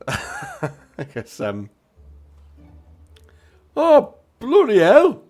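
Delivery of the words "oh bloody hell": loud, high attempt at Scouse; indignant figure in a botched Liverpool accent; bad Liverpudlian accent. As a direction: bellow in makeshift Liverpudlian. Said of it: "I can't do a Liverpudlian accent".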